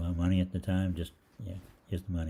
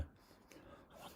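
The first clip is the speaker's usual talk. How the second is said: whispered mimicry; 26 dB quieter than usual. Clerks mimic him among themselves.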